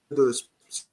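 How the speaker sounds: glitchy robotic connection voice. Real, not acted. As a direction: break up like a bad connection. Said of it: "[name], you sound like a robot".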